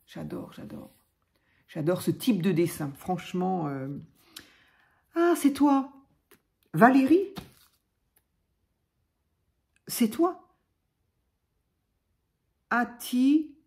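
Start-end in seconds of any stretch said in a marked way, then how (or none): 0.1-0.9 breathy whisper of delight, barely audible. Real, not acted. Admiring a card drawing, she whispers her delight. 5.1-13.5 high and rising with surprise; surprised recognition. Real, not acted. Recognising the viewer who sent the mail, pleasantly surprised.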